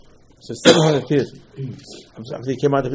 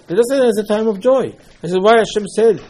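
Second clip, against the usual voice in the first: higher-pitched, insistent objection; retold protest; 8 semitones higher, 5 dB louder than usual. Son objecting that Seder night is for joy, protesting.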